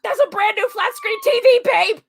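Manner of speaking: hammed-up quoted complaint; mock argument. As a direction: protest loudly as the TV character. Quoting an argument from the episode.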